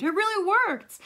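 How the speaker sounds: put-on high, loud amazed kid; excited outburst; delighted exclamation. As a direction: exclaim with relieved astonishment. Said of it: "she exclaimed".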